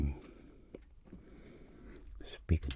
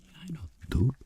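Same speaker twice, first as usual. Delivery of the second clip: high mock reply; 11 semitones higher than usual. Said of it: "And I'm like".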